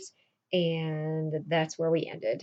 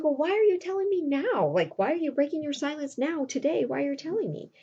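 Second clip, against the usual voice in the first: high-pitched, bewildered demand; incredulous questioning; 8 semitones higher than usual. Acted. Roxanne asks why he broke his silence, incredulous. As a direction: act out disbelief, pitch held high.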